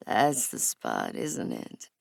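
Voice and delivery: low male lover. A narrator's reading. Kanan teasing her mid-thrust, deep and slow. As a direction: drop low and slow, savoring it. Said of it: "he asks".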